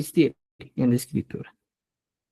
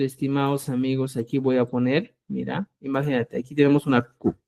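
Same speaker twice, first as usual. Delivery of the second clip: usual speaking voice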